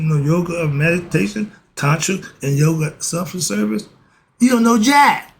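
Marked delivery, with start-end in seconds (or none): 4.4-5.3 a loud, higher jab at the end; emphatic put-down. Real, not acted. Teacher scolding the class, loud and high. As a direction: slam it home, half joking. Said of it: "Sorry about that".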